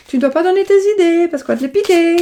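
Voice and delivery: voix de fille bien plus aiguë, pour la taquiner; imite sa fille. Retold or imitated. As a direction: singer la fille qui fait la leçon. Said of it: "qu'elle me dit toujours". (la fille qui reproche à sa mère ses dépenses, voix aiguë imitée)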